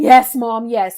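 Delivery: loud, giddy yes; excited insistence. Retold or imitated. Daughter insisting to her doubting mom, loud and giddy.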